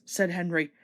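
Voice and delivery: plain reading voice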